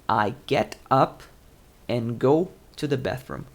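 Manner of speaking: slow model reading. Shown as a demonstration. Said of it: "phrasal verb, get up".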